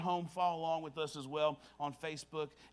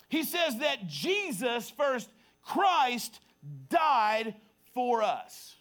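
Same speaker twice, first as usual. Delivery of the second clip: raised, drawn-out emphatic pulpit delivery; high, forceful sermon cadence; preaching; 5 semitones higher, 4 dB louder, much slower than usual. Real, not acted. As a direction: proclaim it slowly, word by word.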